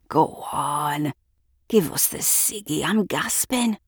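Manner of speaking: a gruff local man, half whispered; rough bloke voice. Acted. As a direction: lean in, wheedling for a smoke. Man begs for cigarette.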